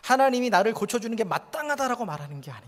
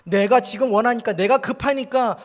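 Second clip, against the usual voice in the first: loud demand; 4 semitones higher, 7 dB louder than usual. Retold or imitated. Mocking impatient demand to God.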